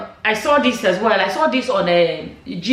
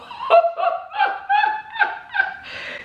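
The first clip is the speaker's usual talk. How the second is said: long high laugh. Real, not acted. Laughing at comment.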